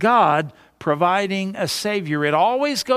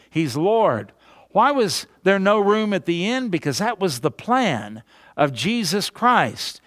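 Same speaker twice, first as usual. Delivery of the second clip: ordinary talk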